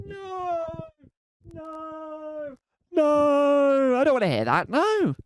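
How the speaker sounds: high, wailing no's, hammed-up; mock horror. Homeowner hearing thrash metal: hammed-up high wail. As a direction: whine it like a horrified cartoon victim.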